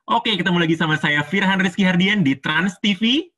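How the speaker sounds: high, dynamic presenter greeting; lively high-toned show opener; upbeat TV host. Shown as a demonstration. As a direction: lift the pitch to rouse the audience. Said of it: "Udah jelas ngambilnya yang nada atas".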